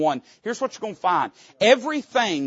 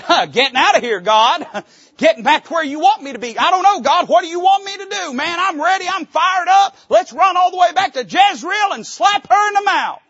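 much higher, loud laughing shout; preacher imagining his own eager answer to God; fired-up bravado; 13 semitones higher, 9 dB louder than usual. Pretend. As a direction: hype yourself up, ready to run. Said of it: "if it'd me, I'd have said".

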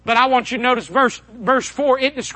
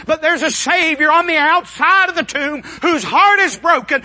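impassioned preaching; 7 semitones higher, 4 dB louder than usual. Real, not acted. Preacher promising sinners a Savior, impassioned and building. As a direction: preach it with mounting force, stretching key words.

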